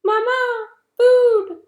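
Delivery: high, loud squeaky hatchling call; tiny gosling cry. Acted. Newborn gosling calling the robot for mama.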